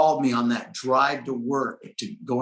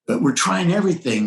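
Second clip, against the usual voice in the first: pleading prayer; 7 semitones higher than usual. Retold or imitated. Pleading with God in retelling.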